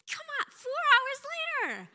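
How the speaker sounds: very high, loud exclamation; excited disbelief. Real, not acted. Telling the crowd God answered within hours, excited disbelief. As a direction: cry it out in sheer amazement. Amazed testimony of answered prayer.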